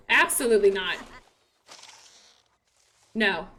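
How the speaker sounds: low, stern forbidding; mock stern parent. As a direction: forbid the flirting like a strict parent. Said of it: "I saw you flirting".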